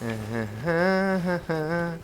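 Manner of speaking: quiet closed-mouth chuckle; soft chuckle. Real, not acted. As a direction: chuckle softly to yourself. Amused reaction during play.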